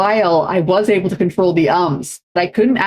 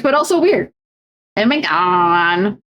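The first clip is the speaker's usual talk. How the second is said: ordinary delivery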